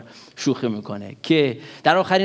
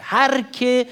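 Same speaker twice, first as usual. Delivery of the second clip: هر که lifted high and pressed; stress on هر که; 7 semitones higher, much slower than usual. Shown as a demonstration. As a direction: lean hard on هر که, the word for everyone. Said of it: "این کلمهٔ هر که".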